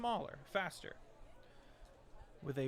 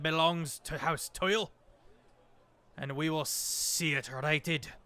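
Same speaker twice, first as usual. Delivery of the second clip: booming overloud voice; 7 dB louder than usual. Acted. Dwarf lord announcing himself to the port, booming overloud. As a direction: boom it out like a giant warlord. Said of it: "in a booming, overloud voice".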